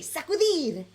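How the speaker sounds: sacudir run together in one quick burst; quick, excited sacudir; super fast. Shown as a demonstration. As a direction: fire off sacudir high and fast, no gaps. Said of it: "say it super fast".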